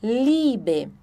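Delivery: slow Liebe, i stretched long, LEEE-be; long German ie. Shown as a demonstration. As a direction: lengthen the i in Liebe. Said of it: "parece que é um I mais longo".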